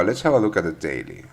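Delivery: normal talking voice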